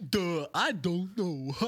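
mock dopey "duh" voice; dim-witted Yank. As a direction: play a clueless dolt, drawn out. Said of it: "usually they're like".

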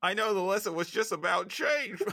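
put-on high Aussie accent, held through the line; Australian Rocco again. Urged to keep going, high Australian Rocco voice. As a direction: keep the Australian whine steady. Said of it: "Keep going".

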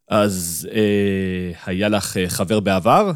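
halting, searching for words; hesitant. A narrator's reading. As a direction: ask it shyly, with pauses. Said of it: "שאלתי בהיסוס". (בהיסוס, בגמגום קל)

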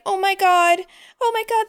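imitating a distraught phone voice, pitched high; mock distress, high-pitched and helpless. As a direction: put on a high, upset voice. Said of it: "like, she sounds distraught".

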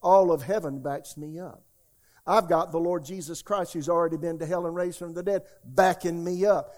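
loud, confident claim of backing; bold declaration. Pretend. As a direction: declare it like heaven's behind you. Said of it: "that's what we're saying".

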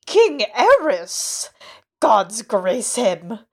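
put-on high, slow, loud old man's blurt; too loud. A narrator's reading. Old man correcting Arya, blurting too loudly. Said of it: "the old man said too loudly".

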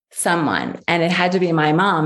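ordinary delivery

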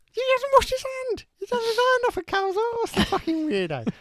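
shrieking outraged bystander; high shocked voice. Pretend. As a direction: screech it high in disgust.

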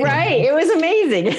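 high, excited, breaking into laughter; delighted agreement. Real, not acted. Guest agreeing the tacos were amazing, delighted and laughing. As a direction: exclaim it on the edge of a laugh.

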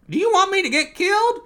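very high, loud comic protest; alarmed squawk. Acted. Donald refusing to go near the bear, high alarmed squawk. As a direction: squawk it in mock terror.

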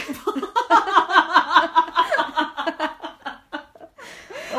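high loud burst of laughter; real laugh, loud and high. Real, not acted. Cracks up at own joke.

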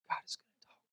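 barely audible whisper; still small voice. Shown as a demonstration. Preacher showing how God is gonna talk, still small whisper. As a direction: whisper it as God's gentle prompting. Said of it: "whispers in a still small voice".

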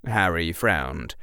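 ordinary delivery, nothing marked

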